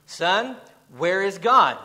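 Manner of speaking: a firm rebuke, voice rising. Retold or imitated. Preacher retelling the clergyman pressing the boy, sterner. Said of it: "with a little more stern tone".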